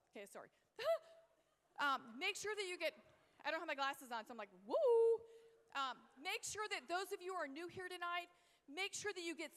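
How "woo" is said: high, loud drawn-out woo; playful high-pitched whoop. Acted. Mimics herself without glasses on.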